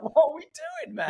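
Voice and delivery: high mock disbelief at the pointlessness of the day job; mock bewildered. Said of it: "and we're like".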